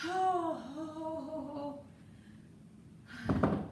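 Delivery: strained oh-oh-oh as the legs burn; effort groans. Real, not acted. Trainer after the final hold, strained groaning oh's.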